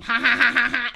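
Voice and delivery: loud forced ha ha; fake laugh. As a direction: laugh it out staged. Mock laugh.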